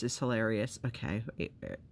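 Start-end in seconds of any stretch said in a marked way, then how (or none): none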